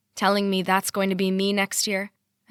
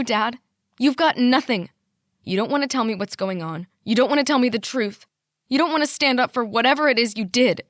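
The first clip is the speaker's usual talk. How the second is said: angry ranting; 3 semitones higher, 6 dB louder than usual. A narrator's reading. Daughter ranting at her father before he hangs up. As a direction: hurl every line at him.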